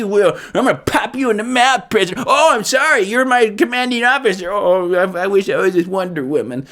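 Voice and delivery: high, exaggerated mock whine; hammed-up high-pitched self-pity; whining Steve Trevor. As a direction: whine and moan it like a sulking hero. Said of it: "he's just been whining. He just whines".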